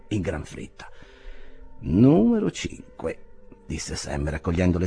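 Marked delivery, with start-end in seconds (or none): 1.8-3.2 slow, higher musing; musing Sam. A narrator's reading. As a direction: read the number slowly to himself. Sam muses. (Sam riflette)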